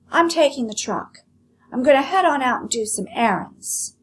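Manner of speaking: loud, high call; shouting. Acted. Lolly shouting to her mother before leaving, loud and high. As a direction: call it out as she leaves. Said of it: "Lolly shouted".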